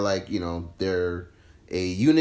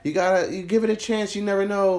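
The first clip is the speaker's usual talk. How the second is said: lightly voiced hopeful fan, higher; mock earnest fan; 8 semitones higher than usual.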